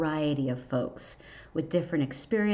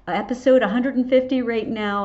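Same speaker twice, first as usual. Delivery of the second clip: louder, higher, punching episode; excited; 4 semitones higher, 6 dB louder, faster than usual. Real, not acted. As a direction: celebrate the milestone. Excited celebration of milestone.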